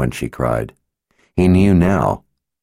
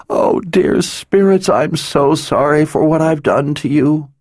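sobbing; 9 semitones higher than usual. A narrator's reading. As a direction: plead through tears, voice cracking. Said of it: "racking sobs".

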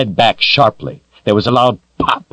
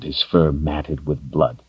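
plain reading voice

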